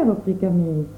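plain reading voice

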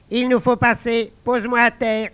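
high, light talking frog; a small frog giving orders. Acted. Frog asking the guard to set her down, high voice.

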